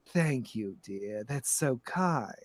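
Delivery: low, soft, slow grande dame; quietly condescending lady; gracious, sinister madam. Acted. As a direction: purr thanks with measured sweetness. Plays Madam Storybrooke.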